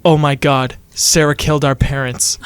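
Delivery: ordinary delivery, nothing marked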